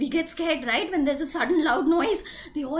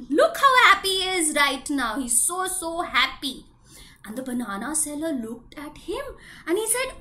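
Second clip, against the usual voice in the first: ordinary delivery, nothing marked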